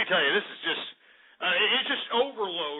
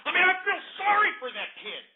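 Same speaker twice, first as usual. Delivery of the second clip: usual speaking voice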